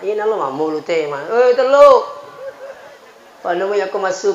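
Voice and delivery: normal talking voice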